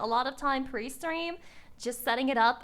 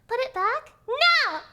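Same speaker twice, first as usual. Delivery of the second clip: high squeal; 10 semitones higher, 6 dB louder, slower than usual. Acted. Squealing protest.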